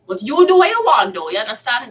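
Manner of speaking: high, loud mock shrug; sassy dismissal. Real, not acted. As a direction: toss it off with attitude.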